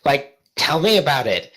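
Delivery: put-on high fast valley girl chatter; valley girl teen. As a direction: gush like a teenage girl. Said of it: "so we'll go teenager".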